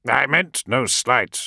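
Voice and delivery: usual speaking voice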